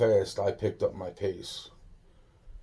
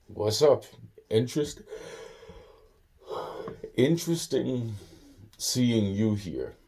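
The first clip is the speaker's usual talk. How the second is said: awkward smart-ass; 3 semitones higher than usual. Retold or imitated. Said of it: "halfway being a smart ass, but it came out stupid".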